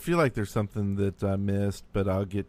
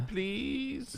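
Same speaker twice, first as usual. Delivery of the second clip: high pleading voice; 13 semitones higher, 9 dB quieter, slower than usual. Acted. Begging playfully.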